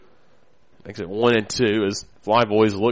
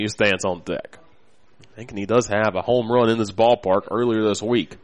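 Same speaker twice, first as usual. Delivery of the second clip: ordinary talk, nothing marked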